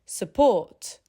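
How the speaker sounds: SUPPORT as suh-PORT, stress on the second; schwa reduction. Shown as a demonstration. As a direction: shrink the first syllable of Support to almost nothing. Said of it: "The first syllable reduces to almost nothing".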